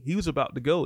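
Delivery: ordinary delivery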